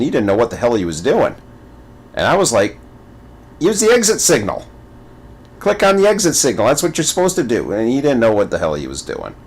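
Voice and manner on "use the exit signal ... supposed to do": high, quick backseat coaching; mock exasperation, pitched up and hurried; retold exasperated urging. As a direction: act out urging a clueless player along. Retold urging a struggling player.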